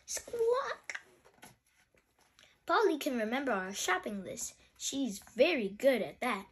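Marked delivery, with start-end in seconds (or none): 0.1-1.0 high-pitched bird squawk; high parrot call. Acted.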